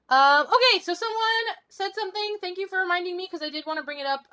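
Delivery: high-pitched, bubbly chatter; giddy. Real, not acted. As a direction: rattle on brightly, wired.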